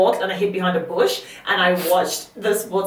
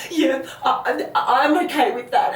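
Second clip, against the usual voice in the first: put-on little boy agreeing; 7 semitones higher than usual. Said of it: "He's like".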